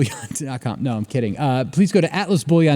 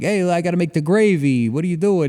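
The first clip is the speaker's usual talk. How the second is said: lightly hammed-up New Jersey mother; Jersey mom accent; 4 semitones higher, 4 dB louder than usual. Host mocking a Jersey woman in a mild Jersey accent. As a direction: play a pushy Jersey mom feeding a guest. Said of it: "on a woman talking about, like".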